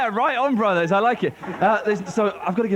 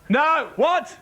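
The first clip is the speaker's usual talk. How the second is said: ordinary delivery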